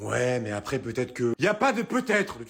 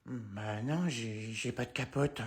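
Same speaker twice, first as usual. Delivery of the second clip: sheepish quiet; 5 semitones lower, 10 dB quieter, much slower than usual. Acted. Kid admits embarrassing problem.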